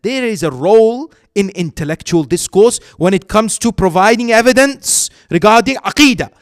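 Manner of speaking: loud, rising sermon climax; preaching at full force. Acted. Preacher drives his proof home to the congregation, loud and fervent.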